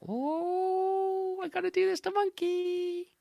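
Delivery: high gleeful trickster voice; scheming spider. A narrator's reading. Anansi eyeing Monkey for the next trick, high and gleeful.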